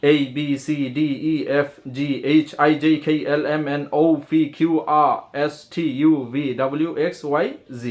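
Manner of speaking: alphabet rushed in one breath. Shown as a demonstration. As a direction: race A to Z without pause. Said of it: "এক নিঃশ্বাসে যেন বলতে পারি". (এক নিঃশ্বাসে এ থেকে জেড)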